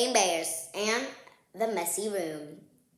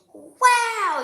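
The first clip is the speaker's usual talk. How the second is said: pitched high and louder, wide-eyed; amazed wow; 12 semitones higher, 7 dB louder than usual. Acted. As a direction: gasp it out in amazement. Brother sees the finished room.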